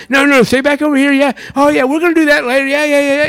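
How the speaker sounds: put-on fast, high, hurried hushing of the kids; a frazzled parent fobbing the kids off quickly.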